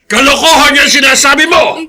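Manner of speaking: loud, high outburst of disbelief; angry denial. Acted. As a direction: hurl the denial back at her. (galit na pagtanggi)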